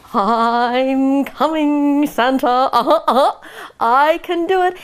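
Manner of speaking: very slow drawn-out cub voice; slow proud little bear. A narrator's reading. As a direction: plod along calling out proudly. Bear carrying rainbow.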